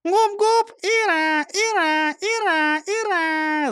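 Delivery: very high squawking parrot cheer; cartoon bird voice. A narrator's reading. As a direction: chirp hurrah like a parrot. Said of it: "защебетала Клара".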